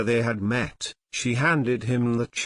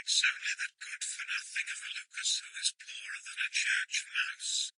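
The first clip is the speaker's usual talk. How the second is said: unvoiced, fast whisper; hurried whisper behind the hand; 12 dB quieter, faster than usual. A narrator's reading. Sexton whispering to the priest about Lucas, a quick whisper. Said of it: "whispered that a christening party was coming".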